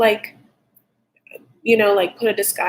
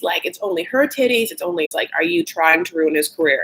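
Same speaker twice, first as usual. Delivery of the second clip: ordinary talk, nothing marked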